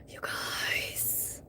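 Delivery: breathless shocked whisper; unvoiced aghast whisper. Real, not acted. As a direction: hiss it in disbelief to the viewers. Shocked aside to viewers.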